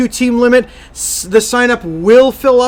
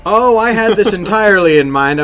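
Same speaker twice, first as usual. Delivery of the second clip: usual speaking voice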